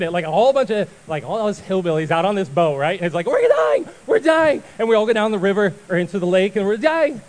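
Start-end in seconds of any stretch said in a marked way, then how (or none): 3.3-4.6 very high, loud comic shriek; mock panicked screaming. Acting out panicked hillbillies on boat. 6.5-7.2 high, loud comic wail; mock panicked cry. Acting out the boat panic again.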